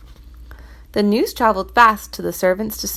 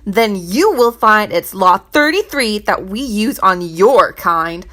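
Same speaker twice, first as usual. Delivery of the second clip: louder, raving treasurer; ranting; 5 dB louder than usual. Acted. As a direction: rant it at the servant. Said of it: "he ranted and raved".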